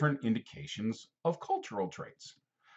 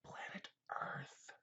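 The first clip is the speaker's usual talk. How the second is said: breathy hushed reveal, much softer; dramatic whisper; 20 dB quieter, slower than usual. Acted. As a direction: whisper it like a big secret.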